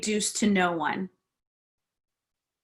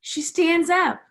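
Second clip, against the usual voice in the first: usual speaking voice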